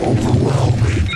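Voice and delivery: awed. Acted. Awed reaction to discovery.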